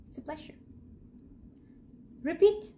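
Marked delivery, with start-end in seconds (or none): 0.1-0.5 soft whispered gloss. Shown as a demonstration. Teacher whispering the meaning to students. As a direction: whisper the translation. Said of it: "whispered aside".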